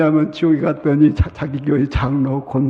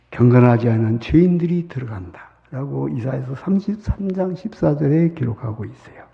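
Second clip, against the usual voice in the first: usual speaking voice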